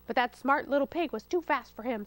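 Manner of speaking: usual speaking voice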